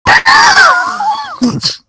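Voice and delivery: high burst of laughter; shrieking laugh. Real, not acted.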